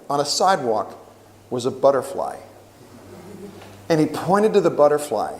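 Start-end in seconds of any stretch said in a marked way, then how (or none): none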